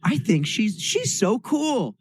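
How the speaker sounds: high, drawn-out fangirl voice; playfully awed, voice rising high; gushing admiration. Retold or imitated. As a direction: gush like a starstruck fan. Retold admiration for new friend.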